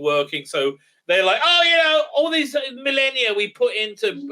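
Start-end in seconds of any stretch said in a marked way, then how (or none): 1.4-4.3 high, loud parody of indignation; mock exasperated complaint. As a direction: whine it up as a hammed complaint. Mocks aliens lamenting wasted effort.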